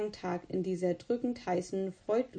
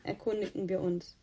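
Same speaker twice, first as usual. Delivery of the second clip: plain reading voice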